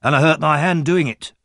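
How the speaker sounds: proud. A narrator's reading. Huge young man boasting to old gentleman, proud. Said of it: "said a huge young man proudly".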